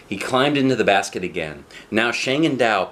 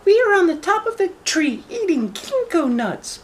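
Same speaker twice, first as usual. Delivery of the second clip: put-on very high little girl voice. Shang teases the wolf from the treetop, high girl voice. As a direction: talk up high like a young girl.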